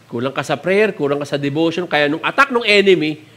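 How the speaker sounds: ordinary talk, nothing marked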